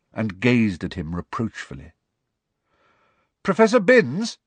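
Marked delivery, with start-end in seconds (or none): 3.4-4.4 loud, high call to the teacher. A narrator's reading. Pupil interrupting the professor in class, loudly. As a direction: pipe up loudly over the class. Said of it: "said Harry loudly".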